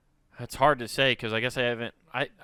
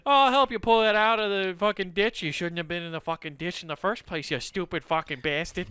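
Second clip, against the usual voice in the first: mock Midwestern scolding, high at first; grumbling Midwesterner; 4 semitones higher than usual. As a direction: help grudgingly while cursing them out.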